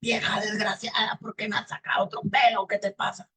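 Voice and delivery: high, rasped devil outburst; enraged devil. Acted. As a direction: scold the hair-puller in a strained devil voice. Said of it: "Y el diablo".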